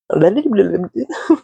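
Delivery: high tongue-tied blubber into laughter; babbling laugh. Real, not acted. Reader stumbling over the reading, babbling laugh. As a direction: babble nonsense, then break into a laugh.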